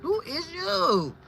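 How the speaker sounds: high, slow mock confusion; God playing dumb. Imitating God pretending not to know her, high and puzzled. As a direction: feign not recognising her. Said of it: "But he gonna say".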